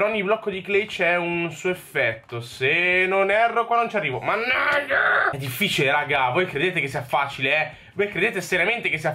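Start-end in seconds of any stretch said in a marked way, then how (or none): 4.2-5.3 high, slow cursing; frustrated curse. Real, not acted. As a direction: drag out the curse. Misses a jump. (imprecazione acuta e strascicata)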